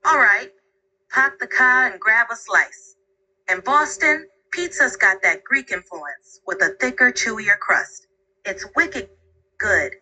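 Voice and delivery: broad Boston accent with local slang; thick Boston accent. Shown as a demonstration. Assistant describing Boston pizza in a thick Boston accent. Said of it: "How about a thick Boston accent?".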